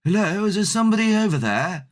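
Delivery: a guard's loud hello into the dark; calling out. Retold or imitated. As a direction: shout over the fence to find someone. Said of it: "there was a guy going".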